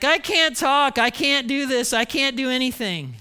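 high, loud mock whine, easing toward the end; hammed-up reluctant Moses; whiny protest. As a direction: play a panicky excuse-maker begging off.

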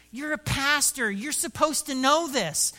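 how can you not know this; incredulous. Retold or imitated. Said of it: "looks incredulous at me".

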